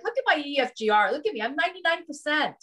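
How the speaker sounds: retold bragging over a good report; proud showing off. Patient showing off results to doctor.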